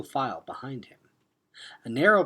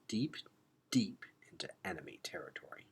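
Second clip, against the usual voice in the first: hushed; 11 dB quieter, faster than usual. A narrator's reading. Creeping into enemy land.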